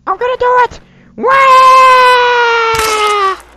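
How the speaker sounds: put-on high squeaky old man yelling; Old Man Jenkins. Old man in a wheelchair yelling, high and squeaky. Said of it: "Old Man Jenkins".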